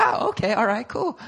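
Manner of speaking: high-pitched breezy acceptance; carefree sanguine student. Retold or imitated. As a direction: chirp it like an easygoing freshman. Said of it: "the freshman student was a sanguine".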